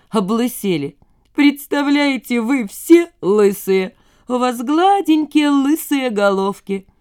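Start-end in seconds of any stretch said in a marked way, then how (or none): 1.4-6.9 slow, high-pitched teasing; clowning mockery. A narrator's reading. Kostya jeering at the silent fan girls, clowning mockery. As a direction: draw it out, higher, mocking the listeners. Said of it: "ёрничал".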